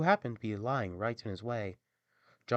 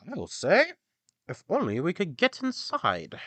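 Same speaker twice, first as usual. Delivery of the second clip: high, loud donkey agreeing; a keen storybook donkey wishing to get in; 9 semitones higher, 7 dB louder than usual. A narrator's reading. Donkey answers the rooster, high loud character voice. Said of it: "the donkey said".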